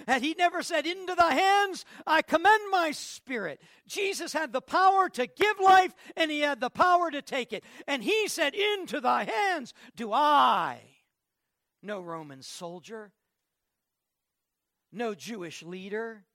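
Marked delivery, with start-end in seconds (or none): 0.1-11.0 loud, high preacher's cry; fiery preaching. Acted. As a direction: preach it out at full force. Preaching at full force.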